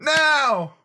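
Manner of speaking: high, loud chant of now; rousing union rally. Pretend. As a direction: shout it like a protest crowd. Protest chant response.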